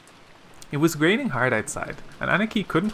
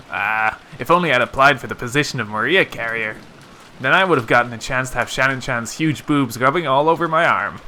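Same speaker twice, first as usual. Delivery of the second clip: loud, exaggerated mock regret; brash teasing joker; 5 dB louder than usual. Cousin joking about missing the job of Maria carrier, loud teasing. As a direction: lament it loudly to tease the others. Teasing character voiced in story.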